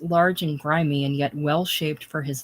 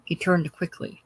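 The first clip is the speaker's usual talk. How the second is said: plain reading voice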